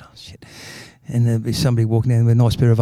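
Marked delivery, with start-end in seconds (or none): 0.0-0.4 breathy whisper, very soft; whispered curse; whispered oh shit. Acted. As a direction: whisper it to yourself, embarrassed. Husband realises mistake.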